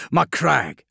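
loud, high exclamation falling at the end; sudden realisation. A narrator's reading. The Lion seizing on the true target, exclaiming. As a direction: burst out with the name.

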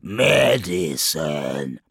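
slow, drawn-out hiss of disgust; low, disgusted demon's hiss. A narrator's reading. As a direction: spit it out slowly, with loathing. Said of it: "he hissed".

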